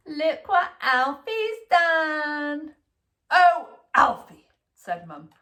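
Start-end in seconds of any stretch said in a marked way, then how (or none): 0.1-2.8 very slow, high telling-tale voice; whining sister. A narrator's reading. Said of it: "whined Alfie's sister". 3.3-4.5 exasperated mum. A narrator's reading.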